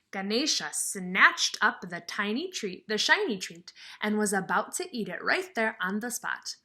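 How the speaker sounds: lively storybook reading; reading to children. Acted. Teacher reading a picture book to her class, lively storybook voice. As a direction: read it out to the kids with some sparkle.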